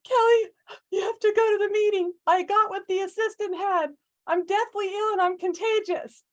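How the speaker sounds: high, loud, pleading voice; hammed-up desperate sick boss; sick boss imitation. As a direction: beg like a sick panicked boss.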